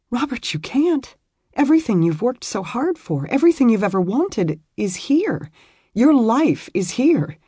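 put-on a torn young wife pouring out her guilt; young woman, distressed. Beth refusing to go home, high and pleading.

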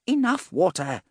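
high, imperious woman's order; commanding. A narrator's reading. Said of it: "the female voice commanded once more".

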